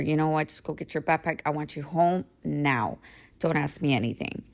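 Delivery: ordinary delivery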